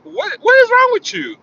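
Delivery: high incredulous cry. Retold or imitated. Shocked at the woman on the phone, incredulous. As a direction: rise in disbelief, shocked. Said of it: "And I'm like".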